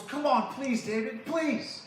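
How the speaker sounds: high-pitched imploring plea; pleading. Acted. Jonathan begging his best friend to stay, pleading. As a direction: beg your friend to agree. Friend begs David to return.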